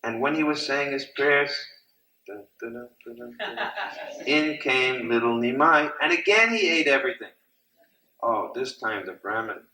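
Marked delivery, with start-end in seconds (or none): 2.3-3.7 soft "dun, dun, dun" with a jump up at the end; suspense sound effect. Acted. Storyteller building suspense for the kids, softly humming dun dun dun. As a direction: hum a tense movie build-up quietly.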